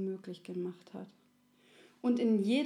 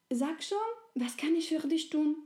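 high, eager pleading ask; little soul; 7 semitones higher, 3 dB louder than usual. Acted. Little soul presses the friendly soul, high and eager. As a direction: nudge a friend to tell you.

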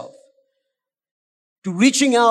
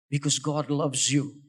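quiet sincere assurance; gentle demo line; 7 semitones lower, 6 dB quieter than usual. Shown as a demonstration. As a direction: say it softly, as if to one person. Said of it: "Jonathan has seen me do this in a restaurant".

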